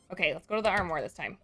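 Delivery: ordinary talk, nothing marked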